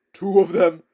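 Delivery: loud anguished horse moan; moaning cry. A narrator's reading. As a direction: cry it out in dread. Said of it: "moaned Bree".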